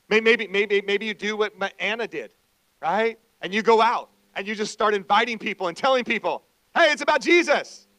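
rally the church, raise the voice; full-force preaching. Acted.

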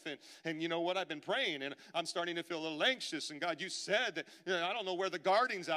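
high, loud preaching of the restless believer's thoughts; preaching, a bit lighter. Acted. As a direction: press the worry out to the congregation. Voicing the anxious believer, preaching.

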